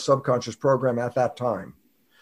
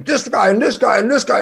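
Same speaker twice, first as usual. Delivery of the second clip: higher-pitched mock argument; imitated bar yelling; 7 semitones higher, 3 dB louder than usual. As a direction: imitate people squabbling in a bar. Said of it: "they're yelling".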